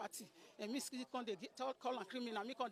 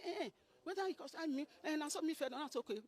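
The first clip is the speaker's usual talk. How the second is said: higher, louder stammering excuse; flustered reply; 6 semitones higher, 5 dB louder than usual. Retold or imitated. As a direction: hem and haw defensively. Boy's flustered answer.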